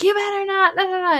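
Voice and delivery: a mother warning her son with the water gun, mock shout. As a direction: threaten the kid like a mom about to snap. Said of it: "she was like".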